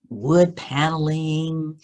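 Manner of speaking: plain reading voice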